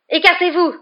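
high, loud urgent yell; forceful cry of command; shouted order. A narrator's reading. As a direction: shout them out of the way. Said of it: "cria-t-il".

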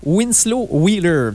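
"Winslow Wheeler" said slowly, with a French speaker's stab at an English accent; slow, careful English-accented try at "Winslow Wheeler"; French speaker mangling "Winslow Wheeler" in an English accent; English accent attempt. Shown as a demonstration. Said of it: "j'ai massacré son nom avec mon accent anglais".

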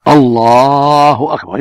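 الله أكبر said slowly, lam of الله lengthened, باء of أكبر short; correct Allahu akbar. Shown as a demonstration. Correcting the questioner, model الله أكبر. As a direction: show the right الله أكبر, long lam only. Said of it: "يمد اللام".

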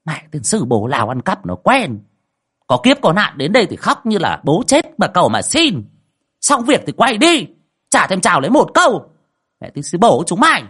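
high, loud scornful rant; a mocking village sorceress cursing ungrateful clients; sneering tirade. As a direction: spit the insults out with contempt. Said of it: "Bà thầy nguýt dài".

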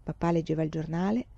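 usual speaking voice

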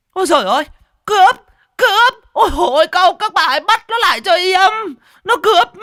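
very high, loud wailing alarm; screaming for help. A narrator's reading. Stall owner chasing the food thief, screaming for help. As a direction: scream out to the whole street. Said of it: "bà vừa gào thét".

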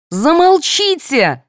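high, drawn-out order; high-pitched exasperated command. A narrator's reading. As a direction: order silence, stretching the word. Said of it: "велела Анюта".

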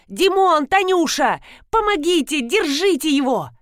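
high, urgent narrated cry; crying out for help. A narrator's reading. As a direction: call everyone to help, pitched high. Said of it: "закричала Ирина Леонидовна". (громкий крик о помощи)

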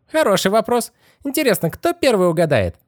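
very high teasing squeak; strange altered voice. A narrator's reading. Skeleton head teasing the children, squeaky altered voice. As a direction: taunt them in a creepy high voice. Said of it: "Этот голос сделался не похож на голос Стэнли".

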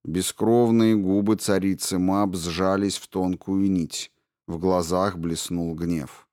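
ordinary delivery, nothing marked

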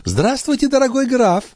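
high-pitched lady's greeting; gracious countess. A narrator's reading. Countess turning to greet the count, high and gracious. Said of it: "после чего обратилась к Бальзамо".

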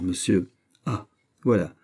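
ordinary delivery, nothing marked

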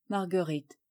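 plain reading voice

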